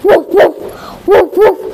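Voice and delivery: short imitated woofs; dog bark. Animals call to the owl, barking. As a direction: bark in paired bursts. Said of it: "called out with the funny voices".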